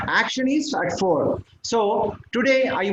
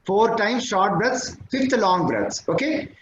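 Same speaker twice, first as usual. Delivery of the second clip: normal talking voice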